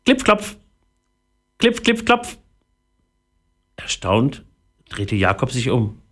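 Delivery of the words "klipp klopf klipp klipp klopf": high, crisp knocking syllables; very high-pitched hammer noise; imitated tiny hammering. A narrator's reading. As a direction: tap out each word high, like a little hammer. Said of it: "wie ein Hämmern".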